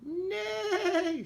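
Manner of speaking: very high horse neigh; whinny like a horse. Acted. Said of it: "said Horse".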